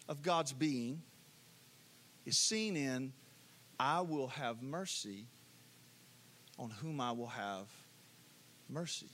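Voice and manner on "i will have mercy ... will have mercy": much softer and lower, almost hushed; hushed, reverent. Shown as a demonstration. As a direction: let the verse sink in, quietly. Preacher repeats verse, hushed.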